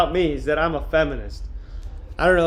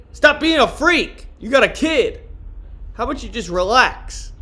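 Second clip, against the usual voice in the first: angry scolding; 6 semitones higher than usual. Real, not acted. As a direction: snap at him to stop being a freak. Scolding a TikTok user who posts as a child.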